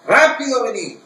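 high, loud hurried order; urgent royal command. Retold or imitated. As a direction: bark it like an impatient king. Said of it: "el rey dijo". (orden urgente del rey, en voz alta)

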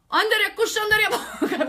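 loud, high burst of tongues; hammed-up shouted prayer through laughter; praying in tongues. Imitates group praying loudly in tongues.

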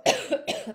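loud harsh cough from a cold; sharp cough from a bad cold; real cough. Real, not acted. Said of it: "tengo un trancazo".

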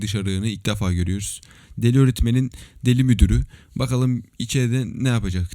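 ordinary talk, nothing marked

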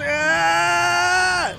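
long held high "aah"; drawn-out scream of struggle; rising wail at the controls; frustrated yell. Real, not acted.

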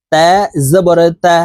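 তা drawn out with a light fronted t; careful, light তা, pause before জবর; thin, light তা. Shown as a demonstration. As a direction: demonstrate thin Arabic তা with an open front vowel. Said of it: "তা হরফ পাতলাভাবে".

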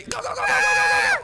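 very high, loud panicky squeal; panicked urging. Pretend. Brother rushing through the dark, panicked. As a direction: squeal it out in a frantic rush.

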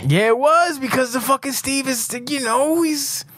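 high-pitched, whiny protest; exasperated defence. Real, not acted. Boss defending his reaction to the list, high exasperation.

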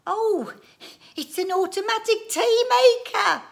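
very high, loud delighted exclamation; excited squeal. A narrator's reading. Wife guesses what the machine is, squealing excitedly. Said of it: "clapped her hands together excitedly".

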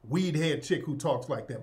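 usual speaking voice

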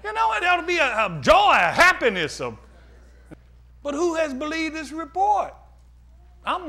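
loud shouted preaching, voice up high; full-force preaching. Acted. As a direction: shout it out to the congregation.

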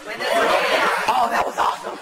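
bursting out laughing at the crowd; laughing with real delight. Real, not acted. Crowd answers back loudly.

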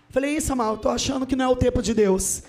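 usual speaking voice

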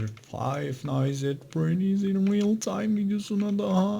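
high, slow, goofy intro voice; silly high voice. Acted. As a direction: stretch the intro in a funny high voice. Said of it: "Niye böyle sesler çıkardığımı".

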